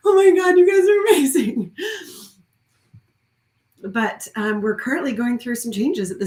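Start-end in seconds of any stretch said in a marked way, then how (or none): 0.1-1.8 high, loud, laughing and crying at once; tearful gush. Real, not acted. To her women's circle, crying and laughing with gratitude. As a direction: burst out, teary and overjoyed. Overwhelmed gratitude to supportive friends.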